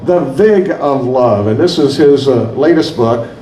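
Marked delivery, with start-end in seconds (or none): 0.0-1.5 "The Vig of Love" said slowly, settling on the corrected "Vig"; careful repeat. Shown as a demonstration. Host to audience, confirming the author's book title, careful and slow. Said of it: "Uh-huh, Vig".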